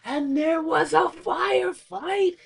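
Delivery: slow, high, drawn-out; Willem Dafoe quote. Retold or imitated. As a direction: savour each word dramatically. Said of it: "he's like".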